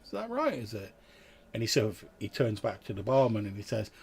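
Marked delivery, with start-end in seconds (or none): 0.1-0.9 high, soft, sceptical "oh"; suspicious. Acted. Ferryman doubting the traveller's memory gap, softly suspicious. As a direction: draw back into quiet, pitched-up suspicion. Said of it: "his eyes narrow and he's like".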